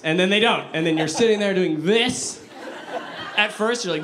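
ordinary talk

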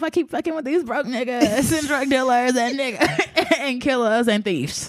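high, drawn-out playful wail; slow, high-pitched comic lament; mock desperate plea. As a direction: beg to be rescued, hamming it up.

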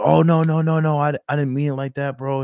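high, loud hurried no no no; flustered backpedal. Retold or imitated. Student apologizing to recruiter, high flustered protest. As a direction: scramble to take it back. Dude backpedals after rude reply.

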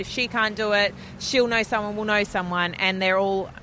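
ordinary talk, nothing marked

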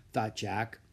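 ordinary delivery

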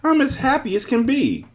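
very high-pitched exultation; happy shout. A narrator's reading. Man delighted at learning who he is, joyful shouting. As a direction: cry out with sheer happiness, voice way up. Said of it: "the man shouted".

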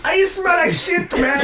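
loud, high, slow mock yell; hammed-up shouted insult. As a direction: bellow the insult like the angry guy. Retold argument, shouted insult.

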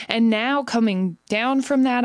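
ordinary talk, nothing marked

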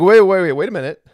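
loud, high burst easing off; mock-alarmed interruption; acted objection. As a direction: cut in like a startled listener. Imagined listener objecting in alarm.

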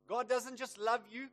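preaching at full force. Real, not acted. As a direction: declare it with conviction. Preacher exhorts congregation.

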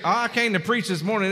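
ordinary talk, nothing marked

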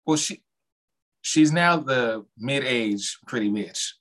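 ordinary talk

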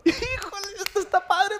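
very high-pitched squeal; gleeful delight. Acted. Son filming mom with dad, high gleeful squeal. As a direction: squeal with mock mischievous glee.

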